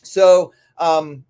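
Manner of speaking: normal talking voice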